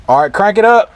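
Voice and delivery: calling out. Real, not acted. Calling to helper at engine.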